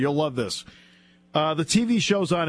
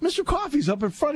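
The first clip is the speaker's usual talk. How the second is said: higher put-on lineman voice; high, insistent voice for the lineman; nose guard explaining; 13 semitones higher than usual. Lineman to Trey about the old man, higher put-on voice.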